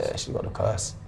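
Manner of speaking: soft whispered aside; whispering. Real, not acted. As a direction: drop to a whisper. Aside about Kim K.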